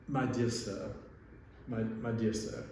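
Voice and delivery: mock-posh British gallery lady; imitated British accent, measured and halting. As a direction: put on your best British accent. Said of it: "I'll try to do my best British accent".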